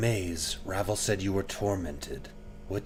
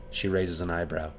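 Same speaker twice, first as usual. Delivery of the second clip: ordinary delivery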